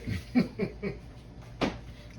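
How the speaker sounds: soft airy laugh to herself; a quiet unvoiced chuckle; little private laugh at the muffin paper; breathy chuckle. Real, not acted.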